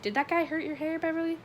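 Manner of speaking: high, shy, tentative question; hesitant. A narrator's reading. Ben blushing as he asks Beverly, hesitant. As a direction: ask it bashfully, voice lifted. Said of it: "Hesitantly blushing furiously".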